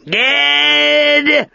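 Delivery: high, drawn-out hoarse cry; hoarse creature voice. A narrator's reading. The Gebeth names Ged before he can cast a spell, hoarse call. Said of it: "saying in its hoarse voice".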